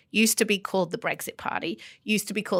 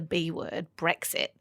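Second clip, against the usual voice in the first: whispered "Brexit", softer; hushed taboo word; 5 semitones lower, 10 dB quieter, slower than usual. Real, not acted. Host naming the word nobody mentions, whispered.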